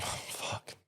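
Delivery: breathy whispered "Fuck" under the breath; whispered curse. Retold or imitated. Finding the mum's place empty, a whispered curse. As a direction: let it slip out as a whisper. Finds the mum not home.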